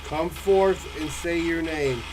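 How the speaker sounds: demanding the spirit show itself; talking to a ghost. Real, not acted. Calling out to a spirit.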